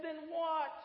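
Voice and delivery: high-pitched, acted exclamation of bafflement; baffled preacher at wits' end; exasperated bafflement. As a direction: demand the answer, voice leaping up.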